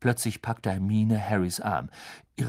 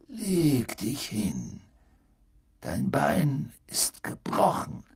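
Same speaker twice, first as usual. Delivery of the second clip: gentle; 3 semitones higher, slower than usual. Acted.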